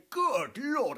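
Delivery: a wide-eyed twit exclaiming; Hastings. Retold or imitated. Hastings gawping at racing cars, high excited exclamation.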